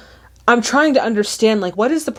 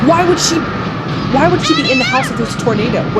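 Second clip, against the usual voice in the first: raised, exasperated question; alarmed disbelief; 6 semitones higher than usual. Real, not acted. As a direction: question the film character in alarm. Alarmed at character's choice in film.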